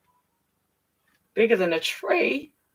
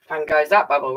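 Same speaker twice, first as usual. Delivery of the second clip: usual speaking voice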